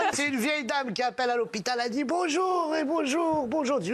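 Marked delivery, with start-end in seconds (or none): 2.0-3.8 lightly voiced old lady, higher; a hint of an old lady on the phone; old lady greeting the hospital.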